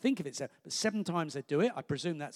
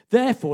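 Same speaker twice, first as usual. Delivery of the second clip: "Therefore" punched much louder and higher; emphatic therefore; 6 semitones higher, 14 dB louder, faster than usual. Shown as a demonstration. Preacher launching into the parable, punchy "Therefore". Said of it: "and then he goes into the parable".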